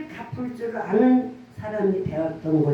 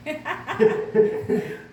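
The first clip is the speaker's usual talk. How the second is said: laugh; 4 semitones higher than usual. Real, not acted. Laughing at her own regret.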